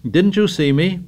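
high-pitched, eager question; eager appeal. A narrator's reading. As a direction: plead for a witness. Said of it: "He felt the need of witnesses".

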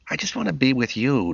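lightly voiced girl, whiny; a hint of a teen girl complaining; complaining daughter. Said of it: "Becky complained".

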